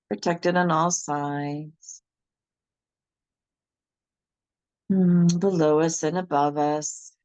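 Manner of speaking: steady, firm meditation guide voice; guided meditation. Acted. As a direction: lead them through grounding step by step. Leads a grounding meditation.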